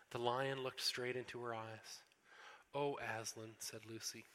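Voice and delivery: storybook reading with voiced characters; story reading. A narrator's reading. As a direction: read it aloud like a bedtime story for the congregation. Pastor reads Narnia story aloud.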